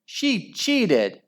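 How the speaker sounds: high-pitched indignant squeal; shriek. A narrator's reading. Henry accusing Margaret, a high shriek. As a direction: shriek it high without full volume. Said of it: "shrieked Henry".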